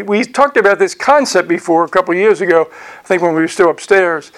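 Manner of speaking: ordinary talk, nothing marked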